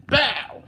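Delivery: high, sudden "bow" for a hit; imitated smack sound. Man retelling a restaurant smack, imitated impact noise. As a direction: mimic the slap landing.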